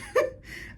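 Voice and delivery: high unvoiced giggle; breathy laugh. Real, not acted. Fan cracking up at Billy's bravado, breathy laugh.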